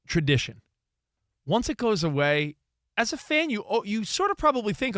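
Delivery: normal talking voice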